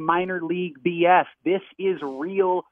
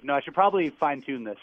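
ordinary talk, nothing marked

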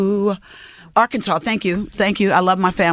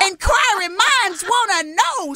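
high-pitched mock-shocked squeal; publicist's reaction. Actress mimicking her publicist hearing of a movie, mock-astonished. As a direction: imitate an astonished publicist in a much higher voice. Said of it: "my publicist is like".

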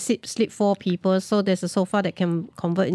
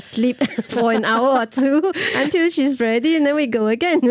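higher-pitched, amused confession; laughing; 5 semitones higher than usual. Real, not acted. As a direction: talk through real laughter. Laughs while admitting their nap stops.

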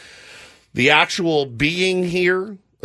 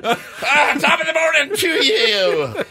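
high, hammed-up Irish greeting with a laugh; leprechaun-style Irish, pitched way up; mock Irish accent; 11 semitones higher than usual. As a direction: put on a silly, high-pitched Irish brogue. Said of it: "Can you make this sound Irish?".

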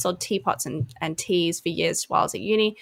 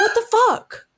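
incredulous what the fuck; 14 semitones higher, slower than usual. Retold or imitated. Reaction to the pub shirt guy.

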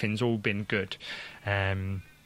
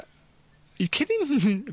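mock disbelief; 4 dB louder, slower than usual. Parents' disbelief at good news.

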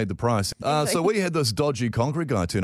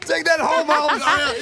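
high, louder laughing orders; hammed-up cranky boss; mock bossy yell; 5 dB louder than usual. Mocking the boss yelling at Miles to dig.

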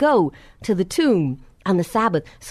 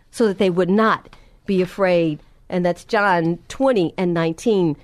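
usual speaking voice

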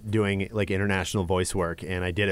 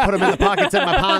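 high laughing voice; 6 dB louder than usual. Real, not acted. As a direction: laugh through the words. Joking, laughing.

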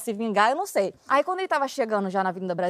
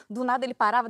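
usual speaking voice

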